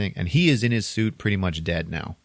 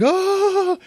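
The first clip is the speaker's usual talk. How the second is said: very high, loud "oh"; mock astonished gasp; 6 dB louder than usual. She suddenly hears him breathing, astonished high gasp. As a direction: let out a high cry of sudden shock.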